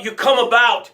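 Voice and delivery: normal talking voice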